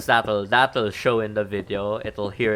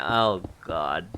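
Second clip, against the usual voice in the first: normal talking voice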